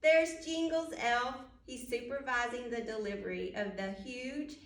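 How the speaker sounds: plain reading voice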